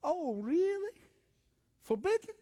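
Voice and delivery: high, rising mock-incredulous questions; exaggerated disbelief to sow doubt; feigned surprise. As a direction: play the tempter acting astonished. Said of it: "What is Satan doing?".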